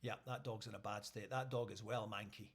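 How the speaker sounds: low, quiet official verdict; understated low-voiced officer; animal welfare officer. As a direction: deliver it low and soft, like a sober assessment. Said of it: "Animal welfare come along and they're like".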